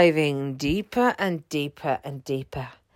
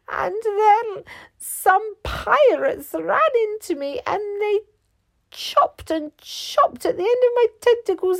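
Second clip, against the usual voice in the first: much higher, plaintive octopus voice; sad lady octopus; 14 semitones higher, 3 dB louder than usual. A narrator's reading. Lady octopus tells the captain about the pirates, high and plaintive. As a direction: tell your woes in a high little voice.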